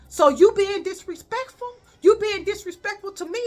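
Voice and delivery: teacher demanding respect; offended challenge. Retold or imitated.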